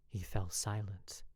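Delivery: usual speaking voice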